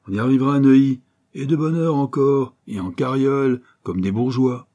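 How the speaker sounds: brash urchin cheering up a younger pal; cocky street kid. Acted. Street kid boasts and reassures.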